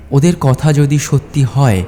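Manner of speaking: usual speaking voice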